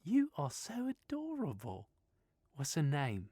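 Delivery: put-on breezy Australian girl voice; easygoing Australian woman reassuring Stephen; Australian accent. Sylvia doting on the dog.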